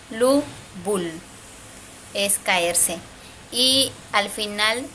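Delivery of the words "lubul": "Lubul" as two separate syllables, lu and bul; middle Maya B. Shown as a demonstration. Teacher repeating the example, "Lubul" sounded out slowly. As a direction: repeat "Lubul" carefully, closing lips on the B. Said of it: "hacemos un sonido con los labios".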